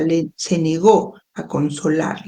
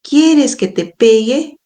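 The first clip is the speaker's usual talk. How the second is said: higher-pitched mock threat, rising question; threatening; 5 semitones higher than usual. A narrator's reading. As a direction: read a mother's threat, voice lifted and testing. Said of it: "recurrió a una clásica amenaza".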